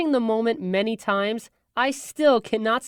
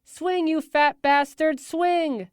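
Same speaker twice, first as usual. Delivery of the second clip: quoted cheering yell; 7 semitones higher, much slower than usual. Retold or imitated. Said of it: "You said, and I quote".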